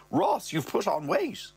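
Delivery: a hint of the mum's voice; mother's voice. Mother remarking on her son, lightly voiced. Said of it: "She goes".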